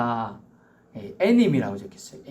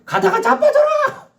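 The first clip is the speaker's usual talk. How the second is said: very high, loud, spiteful shout; mock curse; 7 dB louder, slower than usual. Shown as a demonstration. Preacher demonstrating a curse, high and loud. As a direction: hurl a curse at them. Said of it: "안 돼요".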